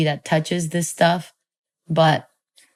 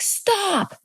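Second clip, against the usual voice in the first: imitated yelling back; 14 semitones higher than usual. Mom yelling back at child, high imitated yell. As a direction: retell mom's yell in a pitched-up voice. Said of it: "my mom was yelling back".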